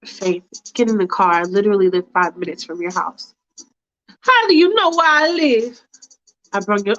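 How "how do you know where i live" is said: higher, prim and hesitant; a fussy woman hedging politely; Faith's fussy voice. Acted. Faith hesitating to the driver, prim and higher.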